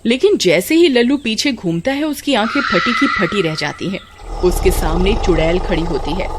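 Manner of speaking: ordinary delivery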